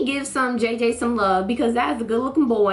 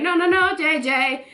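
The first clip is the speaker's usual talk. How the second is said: high, loud protest; 7 semitones higher, 4 dB louder than usual. Retold or imitated. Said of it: "Pope was like".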